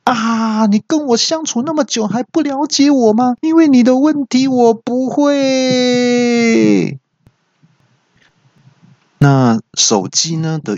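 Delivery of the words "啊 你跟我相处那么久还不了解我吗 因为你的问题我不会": very high, strained wail with a long drawn-out ending; exasperated yell. Acted. Voice assistant confessing it has no answer, high exasperated yell. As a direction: wail it out high, holding the last word. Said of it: "语音也大叫说".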